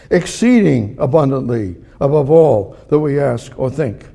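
rising fervor; building to a climax. Shown as a demonstration. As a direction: preach it up to the top. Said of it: "now I'm gonna slide down like this".

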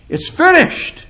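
very high, loud proclamation; sudden triumphant shout; loud, strong cry. Acted. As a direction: belt it out like a final cry. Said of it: "he cried out in a loud, strong voice".

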